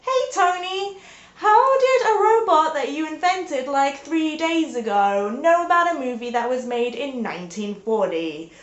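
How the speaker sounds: high, loud accusing question; mock outraged teammate; Avengers teammate. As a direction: demand answers from Tony. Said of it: "Avengers then turn to Tony Stark and be like".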